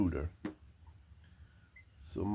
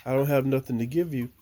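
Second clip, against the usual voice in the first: ordinary delivery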